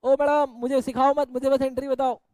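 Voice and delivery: loud, sharp telling-off of a student; exasperated teacher barks at a student; loud scolding. Real, not acted. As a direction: cut her off loudly, don't lecture me.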